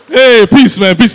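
very loud, showy greeting; mock celebrity swagger, full volume; star greeting fans. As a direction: shout it out to a cheering crowd.

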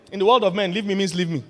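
normal talking voice

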